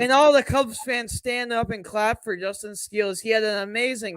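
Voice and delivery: steady sportscaster call; play-by-play. Acted. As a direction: announce it like a broadcaster. Calls the game live.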